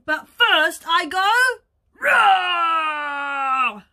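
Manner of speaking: loud build into a long roar; big pretend wolf roar. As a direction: announce it, then let the roar rip. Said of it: "answered the wolf".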